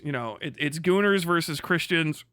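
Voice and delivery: ordinary talk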